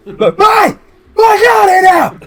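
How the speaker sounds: very high, full-force shout; yelling. Acted. Shopkeeper calling his apprentice in the back room, yelling. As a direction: bellow it through to the back room. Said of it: "just starts yelling".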